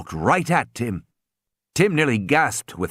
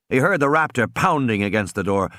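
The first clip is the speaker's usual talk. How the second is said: ordinary delivery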